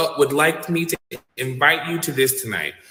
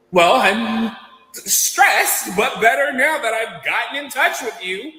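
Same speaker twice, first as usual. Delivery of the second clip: overexcited fan; 7 semitones higher, slower than usual. Retold or imitated. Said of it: "sounding like a crazy person".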